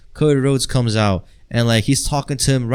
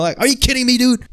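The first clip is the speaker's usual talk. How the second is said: high, incredulous outburst; exasperated; 11 semitones higher, faster than usual. Real, not acted. Vlogger to hater commenters, high incredulous outburst. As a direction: throw it back at them in disbelief.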